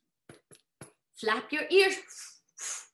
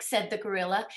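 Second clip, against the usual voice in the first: ordinary delivery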